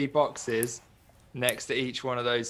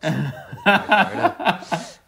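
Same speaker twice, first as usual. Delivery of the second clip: put-on Southern Irish accent; 6 semitones higher, 5 dB louder than usual. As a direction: draw out the Irish accent through a laugh. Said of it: "more Southern Ireland".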